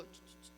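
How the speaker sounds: soft voiceless shushes; three quick quiet shushes; gentle shushing. Acted. God quiets a complaining believer, gentle shushing. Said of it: "a veces Dios te dice".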